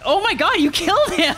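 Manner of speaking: high, loud shocked yell; excited shout. Real, not acted. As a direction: shriek at the death. Character killed in game.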